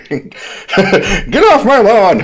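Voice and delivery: high, slow mock old-man yell; hammed-up cranky geezer shouting; grumpy old man.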